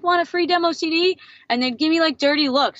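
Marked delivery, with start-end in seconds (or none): 0.0-1.2 higher, bright sales pitch to passersby; eager pitch. Retold or imitated. Young seller offering free demos to passing strangers, eager. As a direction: sell it hopefully, pitched up.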